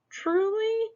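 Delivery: very high, astonished "Truly?"; surprised squeak. Acted. Monster Smug asks his mirror, squeaking in surprise. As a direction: squeak it out in disbelief.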